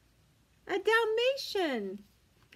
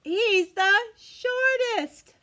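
very slow, higher and louder reveal; dramatic shortest; 5 semitones higher, 5 dB louder, much slower than usual. Acted. Reading to children, slow excited reveal. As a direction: savor the shortest for the kids. Building comparison game with children.

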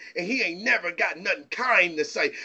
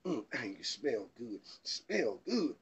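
much lower and softer, a crooning lover's murmur; sweet-talking man; 11 semitones lower, 11 dB quieter, slower than usual. Retold or imitated. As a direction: croon it low like you're wooing her. Man admiring woman's scent.

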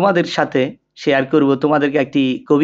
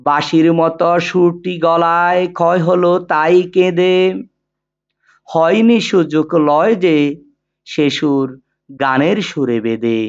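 poem recitation. Shown as a demonstration. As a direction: recite it line by line, sustained and rhythmic, with long pauses between couplets. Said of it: "এখন কবিতাটি আবৃত্তি করছি".

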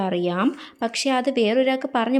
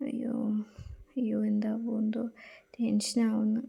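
nervous whisper; 9 dB quieter than usual. Acted. Tense whisper.

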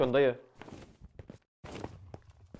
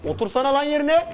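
high taunting yell; 9 semitones higher than usual. Real, not acted. Taunting opponent mid fight.

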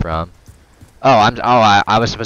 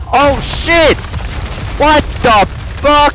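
startled yelp; 3 dB louder, slower than usual. Real, not acted. Startled by enemy in game.

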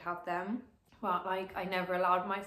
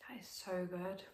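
whispered, blissful praise; quietly relishing whisper; savouring whisper; 9 dB quieter than usual. Real, not acted. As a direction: hush it like a secret pleasure. Savouring the bagel.

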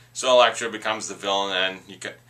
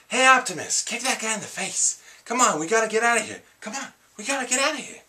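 very high, urgent action-hero impression; Mark Wahlberg; 13 semitones higher than usual. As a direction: put on a high, hurried movie-star voice, urging a buddy along. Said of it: "Is that a good Mark Wahlberg impression?".

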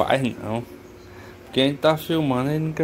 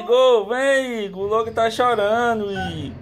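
high, raised voice calling Igor; 8 semitones higher than usual. Real, not acted. Owner calling his nephew because the dog is crying. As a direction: call him in from the other room.

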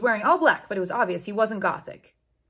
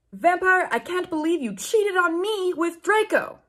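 put-on 1 accusing girlfriend; 8 semitones higher than usual. A narrator's reading. Girlfriend accuses boyfriend of cheating.

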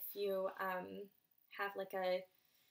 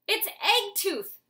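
very high, much louder, slow exclamation; father's high, loud delighted exclamation; exclaimed; 11 semitones higher, 11 dB louder, much slower than usual. Acted. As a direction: burst out in delight. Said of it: "Soren's father exclaimed".

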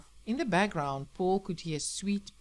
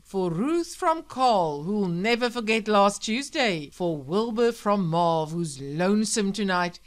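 loud radio announcer; 8 dB louder than usual. Acted. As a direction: project it like a late-night host. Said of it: "All Night Sam cried back at him".

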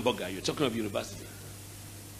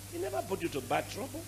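usual speaking voice

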